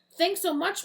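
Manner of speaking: high loud call back; yelled thanks. Acted. Eagle Boy yelling back to Miss Green, loud. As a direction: shout thanks while flying off. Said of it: "he yelled over his shoulder".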